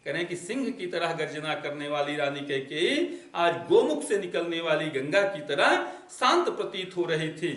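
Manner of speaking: normal talking voice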